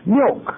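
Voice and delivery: milk. said high and stressed; repeating milk. high for emphasis; emphatic milk. Real, not acted. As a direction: land milk. high again. Repeats the key word.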